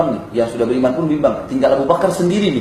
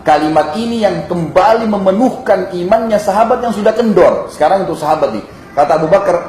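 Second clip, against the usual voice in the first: usual speaking voice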